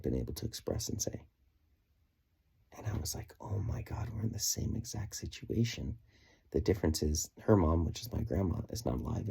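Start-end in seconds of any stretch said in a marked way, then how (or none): 3.4-6.0 hushed amazement. Retold or imitated. Realizes shared situation.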